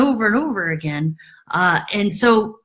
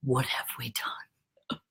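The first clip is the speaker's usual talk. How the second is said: a slow, much softer whisper; stunned whisper; 9 semitones lower, 14 dB quieter, slower than usual. Retold or imitated. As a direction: whisper it in shock.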